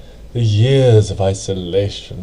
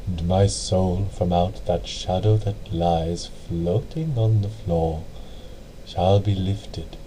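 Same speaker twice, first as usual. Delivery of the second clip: softer, slow dying recital; fading quiet quote of The Raven; 3 semitones lower, 8 dB quieter than usual. A narrator's reading. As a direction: breathe the last lines out softly and slowly. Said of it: "His voice was soft and very slow".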